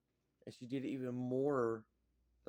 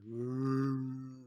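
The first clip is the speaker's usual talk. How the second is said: mock cheer; 6 dB louder than usual. Someone cheering, retold.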